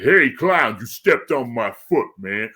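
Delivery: characterful comic-book accent, loud at the start; comic-book big brute. Shown as a demonstration. Actor re-enacting his audition, big brute voice. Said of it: "just do this accent that's really comic book and characterful".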